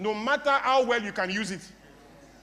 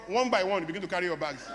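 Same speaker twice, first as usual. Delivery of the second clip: ordinary talk, nothing marked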